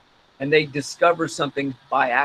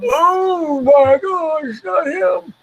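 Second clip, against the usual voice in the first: put-on high, slow, drawn-out old man; hammed-up elderly dismay, pitched way up; Old Man Jenkins; 12 semitones higher, 3 dB louder, much slower than usual. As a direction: wail it like a cartoon old-timer. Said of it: "sound like Old Man Jenkins from SpongeBob".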